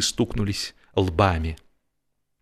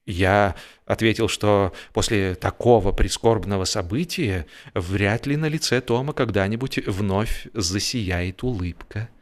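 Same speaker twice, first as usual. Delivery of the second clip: ordinary delivery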